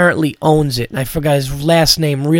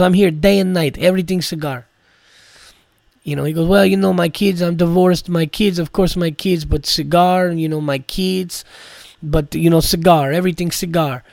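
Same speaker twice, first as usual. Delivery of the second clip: Armenian accent impression; 2 semitones higher than usual. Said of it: "imitating this guy".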